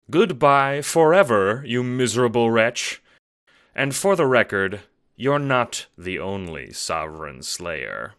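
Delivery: contemptuous gentleman's goodbye; cold villain farewell. Acted. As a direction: slam the door on him with words. Scratch throws him out.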